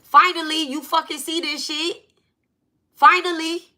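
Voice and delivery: high, bursting voice in the ears; exasperated burst. Retold or imitated. Spirit voice bursting into her ears about the tweet, exasperated. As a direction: burst out with it, pitched high and impatient. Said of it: "it's like a burst came through my ears".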